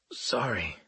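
softly rasped apology; raspy. A narrator's reading. Revived patient to medic whose wrist he grabbed, rasped. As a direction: drop the level and rasp it. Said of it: "I rasped".

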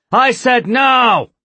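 high, loud, slow yell; furious shout. Acted. Spafford repeating his order to the board, shouting.

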